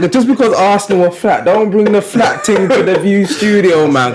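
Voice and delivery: teasing. Acted. Teasing co-host about flat energy.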